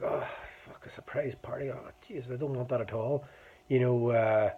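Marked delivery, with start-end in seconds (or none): 0.0-3.2 hushed, dismayed mutter under the breath; muted groan of dismay. Retold or imitated. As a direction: grumble it quietly to yourself. Dreading surprise party, muttered aside.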